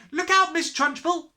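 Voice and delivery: high, loud warning cry; cried out. A narrator's reading. Lavender crying a warning to the headmistress, high and loud. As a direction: call out in alarm. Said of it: "cried Lavender".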